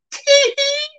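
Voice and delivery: tiny falsetto tee-hee; high-pitched cackle. Acted. Host breaks off the verdict, high put-on cackle. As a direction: squeal out a put-on giggle.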